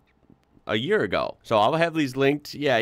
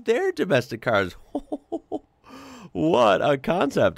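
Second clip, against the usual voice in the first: amused laughter; 7 semitones higher than usual. Real, not acted. Laughing at his own point.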